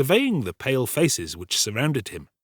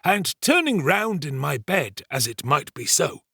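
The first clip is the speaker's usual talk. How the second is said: higher, deliberate pompous servant voice; self-important storyteller Giles; 9 semitones higher, 4 dB louder than usual. Acted. Servant retelling the night's alarm to the kitchen, pompous storytelling. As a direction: hold forth slowly, relishing the tale.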